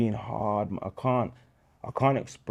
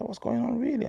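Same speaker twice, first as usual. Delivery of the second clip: mock disbelief. Friend reacts to school fees.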